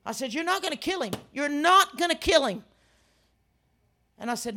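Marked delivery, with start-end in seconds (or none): 0.3-2.7 high and insistent, building louder on the repeat; defiant rebuke. Retold or imitated. Preacher telling the devil off over a patient, defiant. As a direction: stand your ground, hitting "not".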